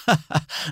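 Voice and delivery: high-pitched scornful laugh; mocking laugh. A narrator's reading. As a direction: laugh derisively at a beaten foe. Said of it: "أطلق أدهم ضحكة ساخرة عالية". (ضحكة ساخرة)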